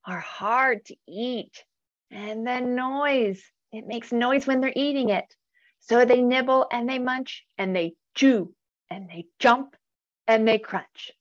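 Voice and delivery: put-on animated storybook reading for kids; reading to children. A narrator's reading. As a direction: read it aloud like a bedtime picture book. Reads picture book to children.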